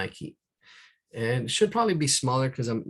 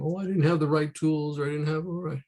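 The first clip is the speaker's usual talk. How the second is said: mock excuse; 6 semitones higher, 4 dB louder than usual. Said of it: "Don't play the blame game".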